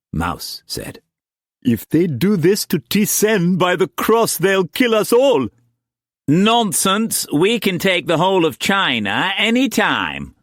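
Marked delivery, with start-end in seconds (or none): 1.6-5.5 high, agitated outcry; panicked alarm. A narrator's reading. Trader reacting to T Sen's scourging, panicked alarm. Alarm after the public flogging. 6.3-10.3 high, forceful scorn; blustering dismissal. A narrator's reading. Trader answering the panic about being killed, blustering dismissal.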